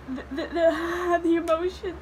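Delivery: slow, stuttering through real tears; half laugh, half cry as you search for the word; overcome with emotion. Real, not acted. Reader explaining her emotions, tearfully overwhelmed. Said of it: "like, I'm crying".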